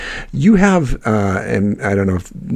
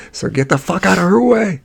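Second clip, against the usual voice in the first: mock yell; 10 semitones higher than usual. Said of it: "yelling at people".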